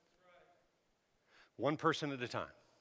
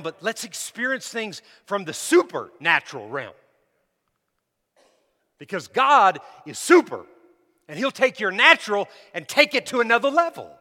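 loud, raised, emphatic preaching; full-force preaching; 8 semitones higher, 14 dB louder than usual. Real, not acted. Preacher urging church toward the supernatural, loud and fervent. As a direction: build it up with punched key words.

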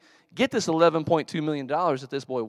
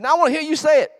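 high, loud, insistent order; imitated loud demand; 14 semitones higher, 10 dB louder, faster than usual. Rod orders Jerry to say it back, loud demand. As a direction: press him hard to repeat it.

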